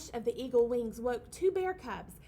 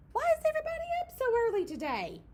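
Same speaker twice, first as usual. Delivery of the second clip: squeaky mouse voice; 10 semitones higher, 7 dB louder, much faster than usual. Acted. Mouse character voice.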